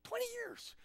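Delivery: high, fast, squeaky-pitched mother; hammed-up incredulous mom voice; astonished mom. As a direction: rattle it off in disbelief as mom. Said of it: "your mom's like".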